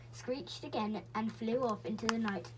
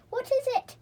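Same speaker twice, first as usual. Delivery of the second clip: hoarse voice; 14 semitones higher, 8 dB louder than usual. A narrator's reading. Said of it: "he asked in a hoarse voice".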